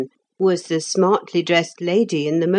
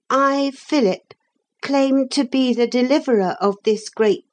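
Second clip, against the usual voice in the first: put-on higher-pitched boy reciting a pledge; boy hero's oath; 8 semitones higher than usual. A narrator's reading. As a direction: recite the vow in a high young voice. Boy recites solemn oath of knighthood.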